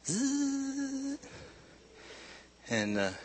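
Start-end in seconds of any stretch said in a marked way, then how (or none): 0.1-1.2 high, steady motor whirr; sustained high-pitched buzzing; motorized chair buzz. Retold or imitated. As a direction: imitate an electric chair coming down the hall. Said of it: "I'd hear him coming down the hall".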